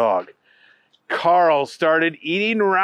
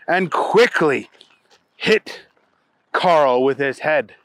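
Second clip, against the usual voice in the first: ordinary delivery, nothing marked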